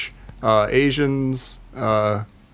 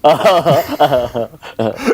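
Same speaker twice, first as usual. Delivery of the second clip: George Takei impression; 4 dB louder than usual. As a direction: roll the words out grandly. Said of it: "Unless it's George Takei".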